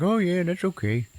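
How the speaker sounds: high, breezy mock agreement; easygoing acceptance. Boyfriend told she's sleeping upstairs, imitated easygoing acceptance.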